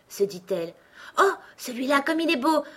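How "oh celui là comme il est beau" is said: high, delighted exclamation; admiring. A narrator's reading. Alice reaching for a beautiful rush, admiring. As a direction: marvel at a lovely find.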